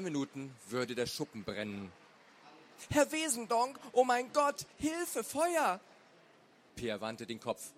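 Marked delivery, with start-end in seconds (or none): 2.9-5.8 high-pitched, loud panicked calling; alarmed cry for help. A narrator's reading. Old neighbour discovering the fire by the shed, alarmed cry.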